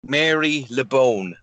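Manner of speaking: slow, careful Irish accent. Shown as a demonstration. Performing on a friend's request, slow Irish accent. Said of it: "can you say Mary LeBone in an Irish accent".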